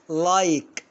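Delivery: لايك said slowly with a clear long "ai"; slow demo of English like. Shown as a demonstration. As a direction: draw out the "ai" in لايك. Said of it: "أنطقها لايك".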